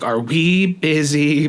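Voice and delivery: slow, trembling question; voice shaking. Retold or imitated. Asking dad about Saturday, voice shaking. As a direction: nerves barely holding. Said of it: "voice shaking".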